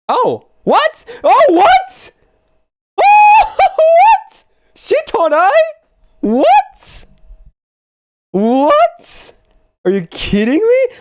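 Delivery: high, loud shocked yelling; screaming what in disbelief. Real, not acted. Unexpected rare pull in gacha.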